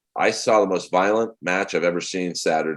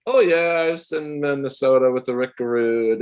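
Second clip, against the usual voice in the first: higher, laid-back American guy voice; breezy Midwestern wrestler chatting; casual American drawl; 5 semitones higher than usual. Retold or imitated. Comedian imitating the wrestler's real American interview voice. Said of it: "cut to these interviews today where he's".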